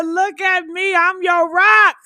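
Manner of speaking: quoting the bride. Retold or imitated. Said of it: "She said".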